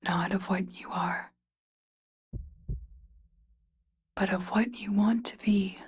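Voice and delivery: hushed and intimate, drawing them in; whisper. Acted. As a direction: whisper it like a voice inside a dream. Dream voice urging the player.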